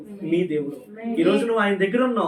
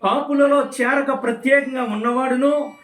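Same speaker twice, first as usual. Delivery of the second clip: raised, declamatory Bible reading; scripture proclamation; 4 semitones higher than usual. Real, not acted. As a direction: ring out each phrase, stretching the endings. (లేఖనాన్ని గట్టిగా ప్రకటిస్తూ చదవడం)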